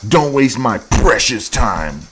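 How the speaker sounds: imitating her demand to men; mock haughty. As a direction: lord it over them, drawn out. Mocking quote of her demand.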